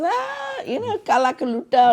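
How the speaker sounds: mock outrage of a gossiping auntie; imitating disapproving aunt. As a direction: put on a shocked older aunt. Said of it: "she does all this".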